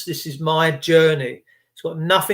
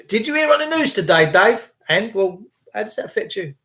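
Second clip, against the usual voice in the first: usual speaking voice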